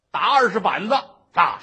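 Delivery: loud command to beat him; stern judge roaring for the rods; barked order. Acted. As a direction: shout the sentence down from the bench.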